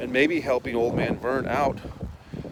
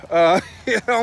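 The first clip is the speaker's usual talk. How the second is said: ordinary talk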